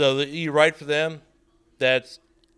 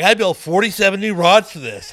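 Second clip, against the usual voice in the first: imitating Mark; 6 semitones higher, 9 dB louder than usual. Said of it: "Mark would have like".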